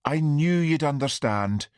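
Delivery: high, lightly pleading voice; pleading mother. A narrator's reading. Mother pleading with uninvited son, high voice. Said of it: "pleaded his mother".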